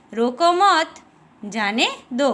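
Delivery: रोको मत as one unit, pause, then जाने दो; pause after मत. Shown as a demonstration. Teacher showing a comma's short stop, pause after मत. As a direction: halt briefly after मत before saying जाने दो. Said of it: "अल्प विराम का प्रयोग वाक्य में थोड़ा रुकने".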